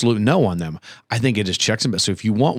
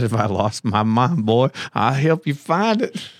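slow imitation of a Southern accent; mock Southern drawl; imitated Southern drawl; slower than usual. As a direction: mimic his Southern line, drawn out. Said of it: "You said".

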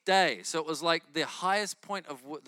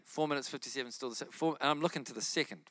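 ordinary talk

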